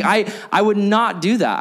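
ordinary delivery

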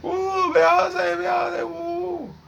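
high, cheering a name like a crowd; fan cheering. Pretend. Mock crowd of [name] lovers cheering her name. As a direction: whoop it up like a screaming fan.

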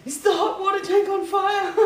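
high, loud alarmed shout; panicked shout. Retold or imitated. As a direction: yell it like the tank's on fire. Retold panic at smoking tank.

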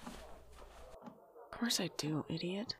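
soft affection. Acted. Tender reply to sister.

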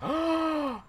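high, drawn-out gasp of shock; sharp intake, appalled. Real, not acted. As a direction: gasp as if scandalized. Mock shock at disliking favorite film.

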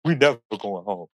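retold panicky wail, lightly hammed-up; mock freak-out. Friend freaking out about missing the flight home, mock panic. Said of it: "Karen over here freaking out".

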